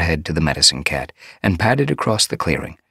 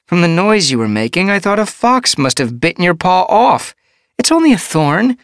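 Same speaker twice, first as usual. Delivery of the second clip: higher, amused exasperated chiding; teasing healer; 8 dB louder than usual. A narrator's reading. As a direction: tease her gently for the fuss.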